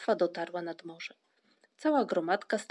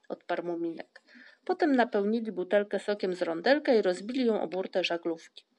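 ordinary delivery, nothing marked

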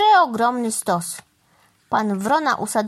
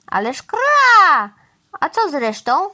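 high, loud crow's protest; indignant crow outburst; exclaiming; 7 semitones higher, 6 dB louder than usual. A narrator's reading. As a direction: squawk out an objection, drawing out the caw. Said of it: "wykrzyknął pan Wrona".

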